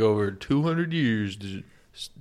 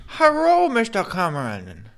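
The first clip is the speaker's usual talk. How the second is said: much higher hello, slow, R's for L's; 9 semitones higher, 5 dB louder, slower than usual. Retold or imitated. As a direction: roll in R's, start very high.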